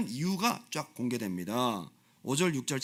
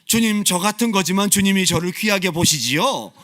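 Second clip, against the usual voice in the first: loud, bold prayer, sure of being precious; confident prayer; 4 semitones higher, 9 dB louder than usual. Retold or imitated. Preacher modelling a confident believer's prayer, loud and bold. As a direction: pray boldly and loudly, claiming worth. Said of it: "그런 아이덴티티를 가지고 기도하시라는".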